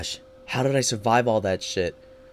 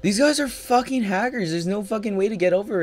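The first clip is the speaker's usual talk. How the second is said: outraged; 9 semitones higher than usual. Real, not acted. Furious at opponents.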